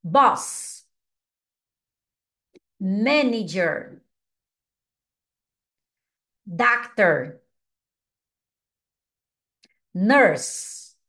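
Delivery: each English word said clearly, with "Manager" slowed; careful teacher model of each word; teacher modelling job words for students to repeat; model pronunciation. Shown as a demonstration. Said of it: "vocês falam depois de mim".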